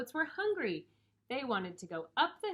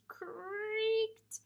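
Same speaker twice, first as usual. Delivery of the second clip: "creaked" stretched out high; high, very slow "creaked"; creaking bridge; 13 semitones higher than usual. A narrator's reading. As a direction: drag out "creaked" like straining wood.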